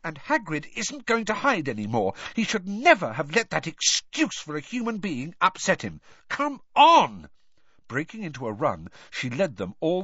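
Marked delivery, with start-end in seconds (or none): 0.0-6.0 high, heated indignation; in a rage. A narrator's reading. Hermione rants on Hagrid's behalf, in a rage. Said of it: "Hermione in a rage like this". 6.3-7.3 high, loud rallying yell; enraged shout. A narrator's reading. Hermione breaks into a run, shouting in rage. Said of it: "Hermione in a rage like this".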